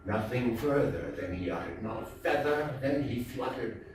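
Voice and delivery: ordinary delivery, nothing marked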